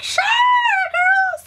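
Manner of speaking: put-on squeaky high sure girl; high eager agreement. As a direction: pitch way up, gushing yes.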